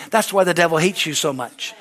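usual speaking voice

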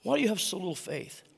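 tender disappointment. Acted. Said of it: "It's almost like Jesus is saying".

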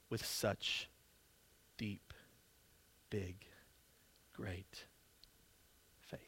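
breathy whispered wonder; awed whisper. Acted. Jesus telling his followers about great faith, whispered in awe. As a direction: hush it, as if the faith takes your breath.

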